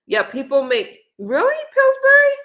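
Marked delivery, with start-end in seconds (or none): 1.2-2.3 surprised. Real, not acted. Surprised reading a chat name.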